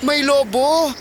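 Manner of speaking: high mock alarm call; boy fooling the villagers again, high urgent cry; shouting for help. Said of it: "Sumigaw siya".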